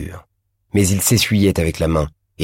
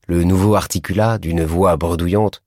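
ordinary delivery

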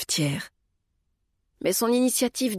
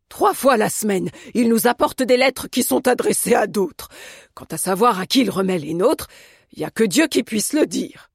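lively, indignant grumbling; scornful, animated character voice; exasperated complaint; 3 semitones higher, 3 dB louder than usual. A narrator's reading. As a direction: complain like a fed-up cook. Cook complains about misdelivered letters.

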